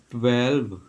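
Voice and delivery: ट्वेल्व said clearly with a crisp "tw" and final "lv"; correct pronunciation. Shown as a demonstration. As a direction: spell out ट्वेल्व sound by sound, ending on a clear v. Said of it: "ये इसकी correct pronunciation होगी". (ट्वेल्व का सही उच्चारण, साफ़ और स्पष्ट)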